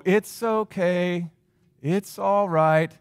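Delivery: very slow, drawn-out reassurance; mock-comforting, stretched-out words; soothing parent. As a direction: soothe like a parent hushing a child. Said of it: "Has anyone had a parent do something like that?".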